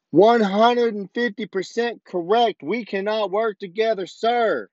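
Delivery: high, mocking indignation; exasperated mock politeness toward the major; sarcastic outburst. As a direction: pitch it way up in sarcastic agreement.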